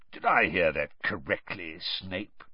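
low, deliberate growly question; slow questioning. A narrator's reading. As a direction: probe him slowly, pitched low. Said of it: "he asked slowly".

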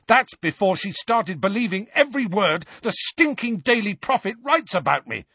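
high-pitched angry tirade; heated, indignant yelling; top of voice. A narrator's reading. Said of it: "at the top of his voice".

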